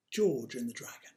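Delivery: usual speaking voice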